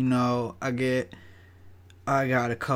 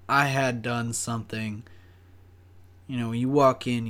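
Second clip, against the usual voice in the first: normal talking voice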